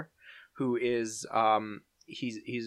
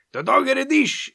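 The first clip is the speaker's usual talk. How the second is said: high, loud imitated scolding yell; mimicked high-pitched garbled German shout; German dad's yell; 13 semitones higher, 8 dB louder than usual. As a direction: yell it like an angry German father. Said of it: "Doof's dad yells at him".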